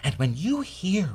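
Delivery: rousing, emphatic testimonial in a high-pitched theatrical voice; Comet monologue. Acted. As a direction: preach it like a staunch believer. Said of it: "what he would say about what Blitzen has just said".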